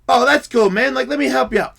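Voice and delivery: high, loud humouring; eager coaxing. Retold or imitated. Helper humours drunk to get him up, eager coaxing.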